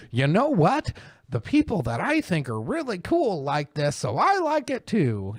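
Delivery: high, dopey voice of a follower; mock airhead. Mimicking the writer's reasoning, high dopey voice. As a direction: play the mindless follower, high. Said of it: "did you just go".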